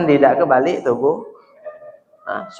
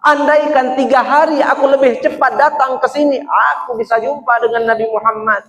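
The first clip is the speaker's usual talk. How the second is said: higher, louder anguished wail; weeping lament; 9 semitones higher, 4 dB louder than usual. Acted. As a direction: grieve for arriving too late. (ratapan sedih bernada tinggi)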